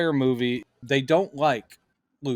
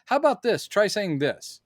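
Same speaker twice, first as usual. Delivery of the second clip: higher writer's voice; 7 semitones higher than usual. Retold or imitated. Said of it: "pitch a line like".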